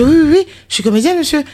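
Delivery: high and loud eager yeses. Retold or imitated. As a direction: answer yes too eagerly. Herself eagerly answering the director.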